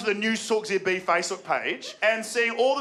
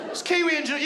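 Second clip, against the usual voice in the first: mock Kiwi bloke; 8 semitones higher, faster than usual. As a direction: brag like a proud ocker Kiwi.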